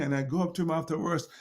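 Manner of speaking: ordinary talk, nothing marked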